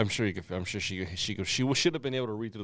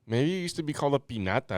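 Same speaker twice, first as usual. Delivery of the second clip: ordinary delivery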